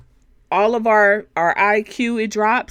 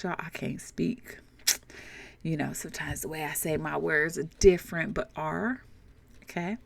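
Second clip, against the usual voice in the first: quiet aside; 3 semitones lower, 9 dB quieter than usual. Real, not acted. Aside about her speech.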